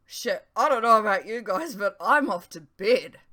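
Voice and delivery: loud, eager, dashing off; can't wait to leave; eager exclamation. A narrator's reading. Talgoth excusing himself to friends, loud and eager.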